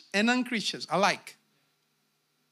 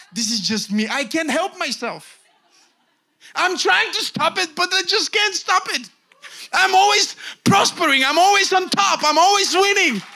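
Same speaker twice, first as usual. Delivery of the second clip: hammed-up confession building to a high, loud shout; mock helpless boasting; 10 semitones higher, 9 dB louder than usual. Preacher mimics someone who can't stop prospering, building to shouted boasts. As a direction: start mock-helpless, build to triumphant yelling.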